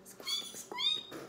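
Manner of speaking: very high, tiny squeaking; nervous mouse squeak. Acted. Nervous mouse squeaks at the kitten. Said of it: "said the mouse nervously".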